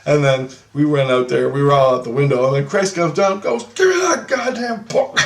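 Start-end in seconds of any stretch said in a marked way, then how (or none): 3.7-5.2 high, shouted scolding; indignant high shout; angry demand. Retold or imitated. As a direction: imitate an outraged grown-up confiscating it. Christ angrily grabs the magazine.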